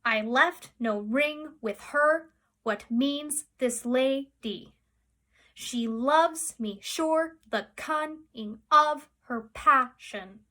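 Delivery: slow, beat out "I left no ring with her" syllable by syllable; scanned verse stress. Shown as a demonstration. Teacher showing feminine endings, "passion" and "dearly" ending on a weak beat. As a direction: mark each iamb, the last syllable of "passion" and "dearly" left unstressed. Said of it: "in the unstressed position. For example".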